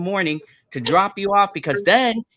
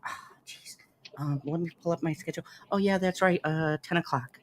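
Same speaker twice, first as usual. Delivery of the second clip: put-on lazy teen mumble; 9 dB quieter than usual. Daughter answers schedule lazily.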